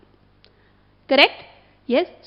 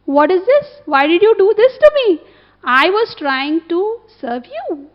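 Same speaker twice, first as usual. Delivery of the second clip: anguished outcry; 5 semitones higher, 7 dB louder than usual. Pretend. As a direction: hurl the question at Ram in anguish. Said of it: "Jatayu could have said".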